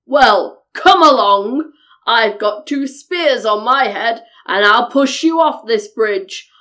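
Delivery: loud, bold billy goat challenge; big goat squaring up to the troll; defiant biggest goat. A narrator's reading. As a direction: declare it fearlessly in a big hoarse voice. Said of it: "declared the biggest billy goat".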